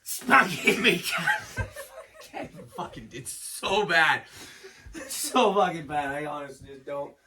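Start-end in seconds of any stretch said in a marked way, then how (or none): 0.0-1.6 loud British caricature, breaking into laughter; mock British accent. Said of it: "they're all the same". 1.6-4.2 talking through real laughter, slowed down; helpless laughter. Real, not acted. Cracking up at his own impression.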